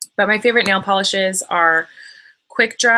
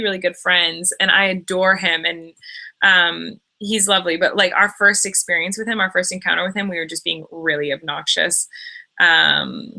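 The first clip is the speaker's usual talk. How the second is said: usual speaking voice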